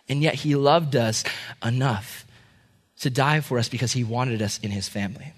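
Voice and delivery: low, soft, reverent tenderness; hushed, heartfelt. Real, not acted. Preacher telling of Christ's love for us, tenderly hushed. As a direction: let the voice sink into sincere quiet.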